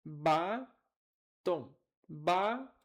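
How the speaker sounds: "Batom" split in two with "Ba" held long and rising; stress on BA. Shown as a demonstration. Teacher hunting the stressed syllable, punching "Ba". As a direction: punch and lift "Ba", drop "tom" after a pause. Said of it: "é a sílaba tônica".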